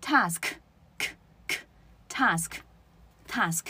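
task very slowly, final k released with a puff; task, k, k, spaced out with airy final k; aspirated ending. Shown as a demonstration. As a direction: model task, isolating k as bursts of air. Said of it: "该送气就送气".

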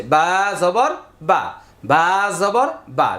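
first "বা" held long, last "বা" short; lingering letter name. Shown as a demonstration. Teacher showing letter versus sound, first "বা" drawn out, last short. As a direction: stretch the opening "বা", then say "জবর বা" with a brief final vowel. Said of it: "হরফ বলার সময় দেরি হচ্ছে".

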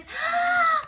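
big high gasp; surprised gasp. Acted. George spotting his new bicycle, startled gasp. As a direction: gasp as the box opens.

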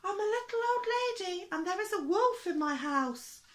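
put-on very high, loud little old lady voice; crying for help. A narrator's reading. Little old lady phoning the police, crying wolf in a high voice. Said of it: "she cried".

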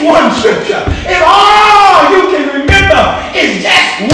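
full-force gospel preaching; fiery preaching. Acted. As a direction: preach it out to the congregation.

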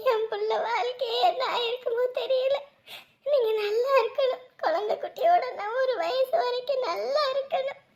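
sobbing, heartfelt blessing; weeping with relief; tearful gratitude. Acted. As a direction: thank them through tears. Mother tearfully blesses doctor.

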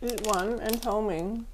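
talking while chewing snack; words muffled by food; mouth full chewing. Real, not acted. Seen 0: Just bit the chocolate stick; lips pressed, chewing through early words.

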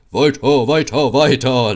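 loud, gleeful bellow; laughing call. A narrator's reading. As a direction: drive them on mockingly at full volume. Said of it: "lachte Gordon vorn".

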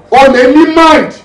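high, loud burst of disbelief; outraged exclamation. Acted. Squad leader on a member's killing, outraged exclamation.

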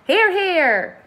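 high, loud cheer in another voice; shouted. A narrator's reading. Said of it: "they all shouted".

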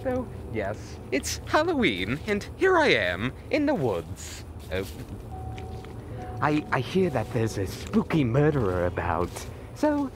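ordinary talk

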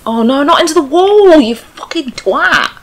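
high, loud angry outburst; exasperated shouting. Real, not acted. Gamer cursing character hitting wall, exasperated shouting. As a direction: shout it, rising in outrage.

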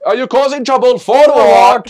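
high, loud mock Indian accent; indignant questioning in a put-on Indian accent; hammed-up Indian accent, rising to a shout. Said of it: "this next Indian accent, or whatever accent this is".

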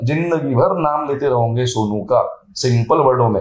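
ordinary delivery

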